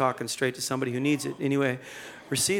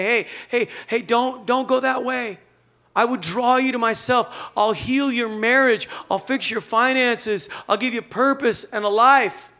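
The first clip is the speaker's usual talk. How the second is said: higher, urgent pleading call, held high; pleading Jesus; 6 semitones higher, 3 dB louder than usual. Retold or imitated. Jesus calling out to a straying person, pleading higher. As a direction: beg them not to go that way.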